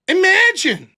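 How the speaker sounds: high, loud burst of disbelief; loud stunned Imagine. Real, not acted. Streamer reacts to Connor's kids: loud disbelief. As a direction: throw the word out in shock.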